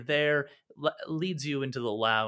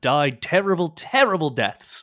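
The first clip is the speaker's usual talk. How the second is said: louder, righteous condemnation; indignant merchant; 8 dB louder than usual. Acted. Merchant condemning the pirates, loud indignant. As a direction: spit out the contempt for pirates.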